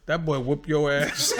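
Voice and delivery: low, slow, laughing deadpan; mother's blunt verdict. Retold or imitated. Mother telling her son after the fight, slow and laughing. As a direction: drop it slow and low, amused. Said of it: "she said".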